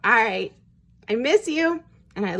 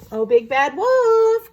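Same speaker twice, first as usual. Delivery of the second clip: high, loud, slow call; crying out; 8 semitones higher, 7 dB louder, slower than usual. A narrator's reading. Missus Duck calls the wolf back, crying out. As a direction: call after someone with long drawn-out words. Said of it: "cried Missus Duck".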